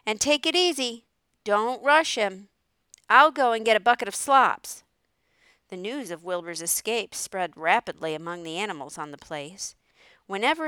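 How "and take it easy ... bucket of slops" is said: loud, higher-pitched farm character voice; farmer giving orders. Acted. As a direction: call out firm instructions over the yard.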